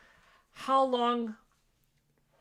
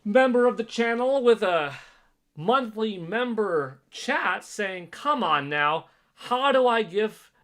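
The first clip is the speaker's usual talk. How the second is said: loud, high announcement of a chat; announcer read; 8 semitones higher, 11 dB louder than usual. Real, not acted. Streamer announcing a member's chat to viewers, loud announcer read. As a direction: belt out the member's message.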